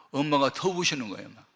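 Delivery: usual speaking voice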